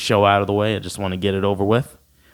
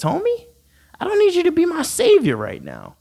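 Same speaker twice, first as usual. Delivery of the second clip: high mocking rant.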